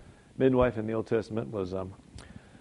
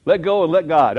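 proclaimed; 5 semitones higher, 12 dB louder than usual. Shown as a demonstration. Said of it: "sounds great to say".

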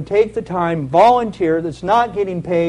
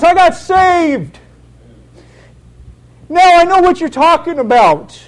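put-on excited boy; 11 semitones higher, 7 dB louder than usual. Boy excitedly shares news.

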